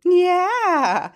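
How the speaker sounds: high, drawn-out cheer. Real, not acted. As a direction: cheer the little ones on, high and long. Cheers after the rhyme.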